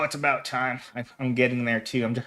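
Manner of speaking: ordinary talk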